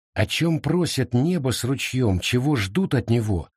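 plain reading voice

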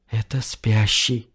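slow, soft, awed whisper; trembling whisper. A narrator's reading. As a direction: breathe it out slowly in awe. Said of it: "прошептала Мира". (дрожащий тихий шёпот)

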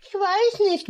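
sulky, high murmur; high, sad heroine voice; sad girl voice. Acted. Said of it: "murmelte sie traurig".

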